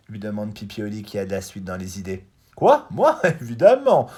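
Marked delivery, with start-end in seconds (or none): 2.6-4.1 high, loud cocky bird voice with a laugh; a show-off bird scoffing at the question; boastful blackbird. Acted. Blackbird boasts he knows Africa.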